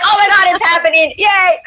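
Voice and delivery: imitating thrilled fans, high-pitched; excited fans. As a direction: squeal as the chat would, mock thrilled. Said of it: "They're like".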